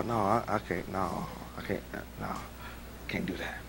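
dropping to a slow whisper; hushed inner refusal. Acted. Pastor voicing his conscience to the church, fading whisper. The word checking him inside.

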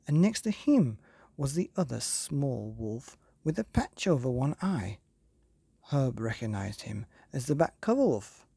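plain reading voice

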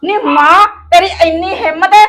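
loud, high, angry challenge; furious. Acted. Daughter-in-law furiously confronting her mother-in-law, loud. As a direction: demand who she thinks she is.

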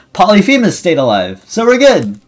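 high, loud burst of relief; excited relief. Real, not acted. Player whose Polyphemus survived, loud relieved cheer. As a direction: call it out high and triumphant.

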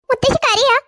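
sudden high, surprised question; a child shocked to see grandma cry; startled child. Acted. Child finds grandmother crying. (ഞെട്ടലോടെ ഉയർന്ന ശബ്ദത്തിൽ)

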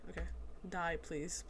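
ordinary delivery

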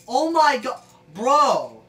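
loud cry of disbelief; exasperated outburst. Real, not acted. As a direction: exclaim it loudly, fed up. Another failed launch.

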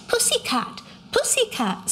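put-on high, babyish little-girl voice; small toddler voice. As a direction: coo it like a tiny child. Little Maudie voiced by reader.

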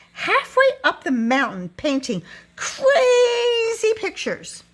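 high, drawn-out cry on "crazy"; scornful exclamation. A narrator's reading. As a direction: send "crazy" soaring, then drop on "pictures". Lady mocks the mountain painter.

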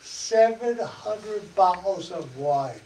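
ordinary talk, nothing marked

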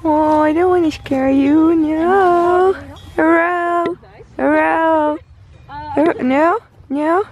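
put-on high, coaxing baby-talk to a cow; soothing a cow. Speaker coaxes cow not to be scared, soothing. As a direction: coax the cow gently, high.

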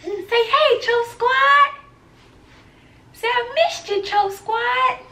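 high, sing-song coaxing. Real, not acted. Coaxing baby to speak.

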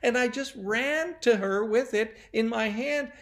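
put-on high, loud boy's wail, building; acted tearful confession. A narrator's reading. Timmy confessing to Susan about the candy, mock sobbing. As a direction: wail it like a distraught child, peaking on the confession. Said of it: "He sobbed and he sobbed".

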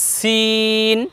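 সিন with a thin s and long held vowel; thin seen. Shown as a demonstration. Teacher names the new letter, long thin সিন. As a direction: hold সিন out softly on a level note. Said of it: "একটু চিকন আওয়াজ হবে".

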